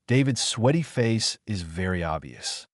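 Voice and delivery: ordinary delivery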